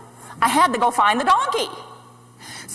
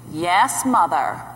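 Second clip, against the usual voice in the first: low, droning son's reply; lower-pitched, dutiful teenage drone; mimicked dull son; 7 semitones lower, slower than usual. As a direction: mimic a bored son answering mother.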